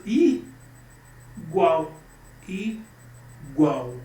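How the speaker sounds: "igual" broken into "i", long pause, "gual"; two separated beats in "igual": i... gual; syllable by syllable. Shown as a demonstration. Teacher demonstrating two syllables to the class, "igual" syllable by syllable. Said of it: "Duas sílabas".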